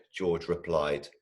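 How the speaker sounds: plain reading voice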